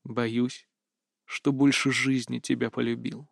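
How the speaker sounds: soft, low, intimate admission; murmured confession. A narrator's reading. Kris confesses love at the last kiss, hushed and low. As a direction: confide it quietly into the ear. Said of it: "дошептал несказанное на ухо".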